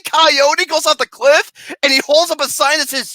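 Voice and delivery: ordinary delivery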